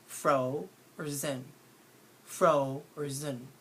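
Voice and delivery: fro or zen said slowly as two separate parts; slow, split fro or zen; syllable by syllable. Shown as a demonstration. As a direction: stretch out fro, then zen, to compare them. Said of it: "which one is the lazier part".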